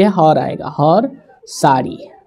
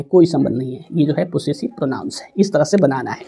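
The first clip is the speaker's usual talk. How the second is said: ordinary delivery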